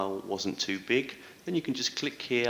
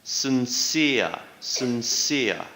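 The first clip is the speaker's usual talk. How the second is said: Sincere said louder, stress on -cere; stress on second syllable; 4 dB louder, much slower than usual. Shown as a demonstration. Teacher modelling Sincere for students, stress on -cere. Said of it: "Stress is on the second syllable".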